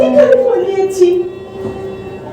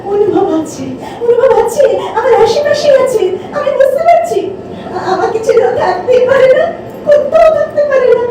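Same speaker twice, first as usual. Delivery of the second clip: tearful, half-laughing denial; distraught insistence. Acted. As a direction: insist through sobs that he lives. Grieving woman insists he lives.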